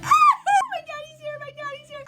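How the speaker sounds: very high-pitched mock squeal; squealing excitement. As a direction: reenact bursting with joy at dad's arrival. Excited on seeing dad, retold.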